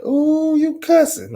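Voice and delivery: put-on very high squeaky tattling kid; mocking kid voice. Kids catching him cussing, high squeaky tattle. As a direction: squeal it like a shocked little kid. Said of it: "kids looking at me like".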